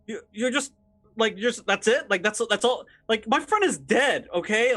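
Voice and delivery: high-pitched, stammering disbelief; serious upset reaction. Acted. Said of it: "she's starting to have, like, a, like, a, serious reaction".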